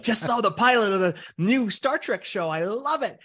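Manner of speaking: high, eager fan voice, mildly mocking; online fan. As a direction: gush like a fan typing in a chat room. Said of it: "and people were like".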